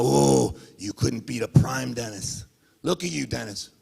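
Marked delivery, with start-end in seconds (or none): 0.0-2.5 a breathy mock fan whine; mock whining fan. Mocking Dennis's crying fans, lightly imitated whine. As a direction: lightly mimic the whining fans, then drop low. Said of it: "All I hear is crying from your fans".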